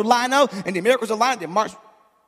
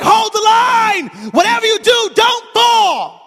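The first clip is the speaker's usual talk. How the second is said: high, loud rallying shout; drawn-out commander's yell; battle cry; 13 semitones higher, 8 dB louder, much slower than usual. Retold or imitated. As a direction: roar it out to troops in battle. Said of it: "He's like".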